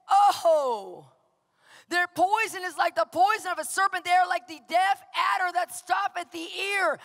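high, loud preached verse; shouted scripture. Acted. Pastor reminds congregation of the psalm, loud high preaching. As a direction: thunder the verse at the crowd.

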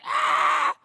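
high, loud mock scream; shrieking comic cry; squeezed-to-death scream. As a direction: act out being crushed by a snake. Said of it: "If a big snake was squeezing".

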